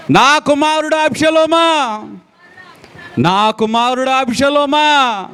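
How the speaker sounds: slow, high wail for a lost son; preacher voicing King David crying for his son; grieving cry. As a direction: draw out the name like a father's lament. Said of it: "అని కేకలేస్తున్నాడండి".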